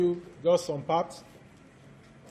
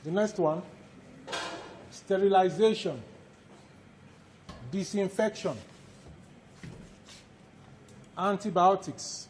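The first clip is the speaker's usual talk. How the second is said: dictation. Acted. Lecturer dictates course outline.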